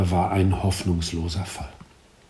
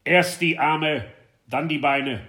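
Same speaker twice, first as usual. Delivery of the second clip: commanding teacher; 6 semitones higher than usual. A narrator's reading. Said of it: "kommandierte gerade".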